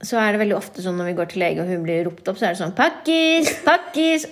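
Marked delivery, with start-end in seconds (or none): 2.8-4.3 higher mock receptionist call; calling out a name. Receptionist calling the patient's name, raised mock call. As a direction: call the patient's name across the waiting room.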